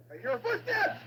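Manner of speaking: shrieked high, loud callouts; frantic shouting. Real, not acted. Gamer fleeing the monster, shrieking directions. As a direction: yell each direction in panic.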